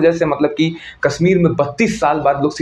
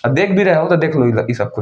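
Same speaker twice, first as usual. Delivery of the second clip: ordinary talk, nothing marked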